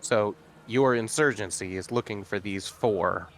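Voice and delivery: ordinary talk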